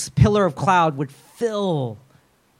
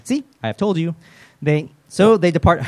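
normal talking voice

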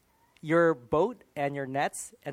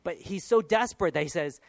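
usual speaking voice

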